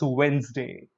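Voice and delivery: "Wednesday" as "wenz-day", D silent; correct Wednesday. Shown as a demonstration. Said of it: "correct pronunciation".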